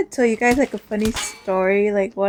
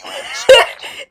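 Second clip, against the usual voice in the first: laugh; 13 semitones higher than usual. Real, not acted. Punchline of funny story.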